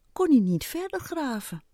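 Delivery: usual speaking voice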